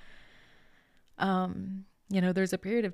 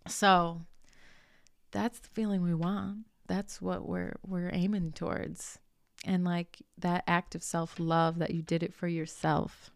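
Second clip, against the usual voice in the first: ordinary talk, nothing marked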